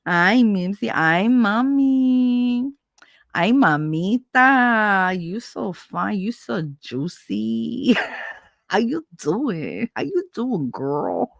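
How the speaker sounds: slow, drawn-out sleazy flirting; sleazy catcaller. Retold or imitated. Catcaller crooning at Mimsy, slow and sleazy. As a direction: stretch each line out like a creep.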